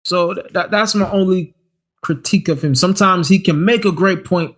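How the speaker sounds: usual speaking voice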